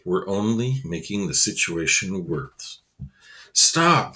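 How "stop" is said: urgent call. Acted. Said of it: "thought Jeremy".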